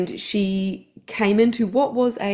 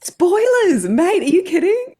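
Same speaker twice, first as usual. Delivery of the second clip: high, loud playful scolding; mock outrage; 11 semitones higher, 6 dB louder, slower than usual. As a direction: cry out in laughing disbelief.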